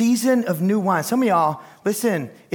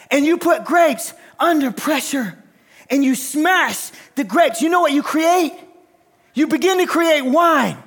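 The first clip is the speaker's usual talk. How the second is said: preaching; 9 semitones higher, 4 dB louder than usual. Acted.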